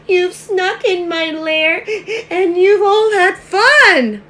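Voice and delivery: loud, high storybook wail; hammed-up tearful complaint; bawling sad bear. Bear left out of the fun, hammed-up bawling. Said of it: "he's sad because everyone had fun".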